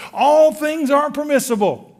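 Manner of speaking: loud, high ringing proclamation; preacher quoting Paul to the congregation, proclaiming loudly; proclaiming scripture. Acted. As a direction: belt out ALL as the preacher's cry. Preacher proclaims Paul's quote.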